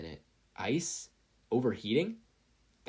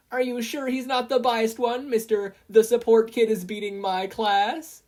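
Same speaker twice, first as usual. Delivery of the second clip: high, loud mocking reply; loud teasing announcer; 12 semitones higher, 10 dB louder than usual. A narrator's reading. Present Mike teases Eraserhead, loud high announcer. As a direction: needle him like a loud host. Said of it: "Present Mike".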